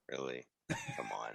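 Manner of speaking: mocking the movie, low and quiet; deadpan disbelief. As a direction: mutter it deadpan. Sarcastic, much lower quiet.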